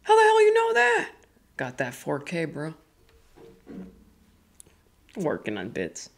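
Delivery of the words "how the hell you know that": high startled voice; mock outrage; shocked. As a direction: jump up in pitch, baffled. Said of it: "I'm like".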